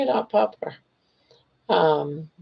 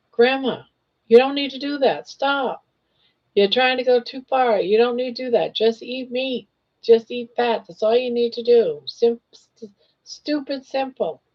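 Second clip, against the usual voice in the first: high, fast, preachy imitation of know-it-alls; mock the people who keep giving advice; lecturing advice-givers; 6 semitones higher than usual. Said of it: "a lot of people want to tell me".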